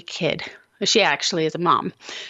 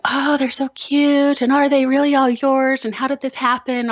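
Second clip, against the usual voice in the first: gushing lady; 6 semitones higher, 4 dB louder than usual. Retold or imitated. Mom mimicking curious lady at the mall, high gushing coo. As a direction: coo it like a nosy admirer. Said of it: "this lady's talking to me and saying".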